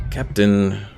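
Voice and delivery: usual speaking voice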